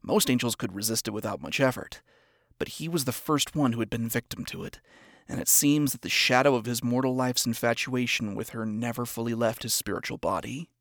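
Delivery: ordinary delivery, nothing marked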